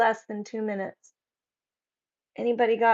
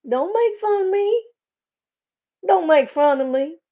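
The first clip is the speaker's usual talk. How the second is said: high, loud playful protest; mock plea; 8 semitones higher, 5 dB louder than usual. Streamer joking with chat about accents, mock plea. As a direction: plead in an exaggerated, pitched-up voice.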